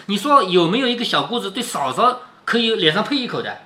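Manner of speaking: ordinary talk, nothing marked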